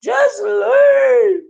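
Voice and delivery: high, loud shrieked leave; mock yell. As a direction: yell it back in mockery. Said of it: "I said".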